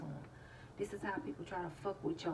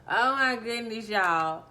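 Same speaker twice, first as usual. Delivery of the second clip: loud exclamation after laughing; worn out from real laughter; tickled; 5 semitones higher, 22 dB louder, slower than usual. Real, not acted. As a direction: cry out, then a high whoop. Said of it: "I hope that tickled y'all".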